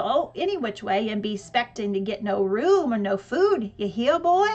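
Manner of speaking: dad teasing the kids in a put-on hillbilly accent; mock hillbilly accent. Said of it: "made an imitation of a hillbilly accent".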